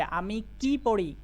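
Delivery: slow line, কি stretched and stressed; teacher's emphatic, lengthened কি. Shown as a demonstration. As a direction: lean hard on কি, then fall. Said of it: "কি এর ওপরে জোর দিতে হবে".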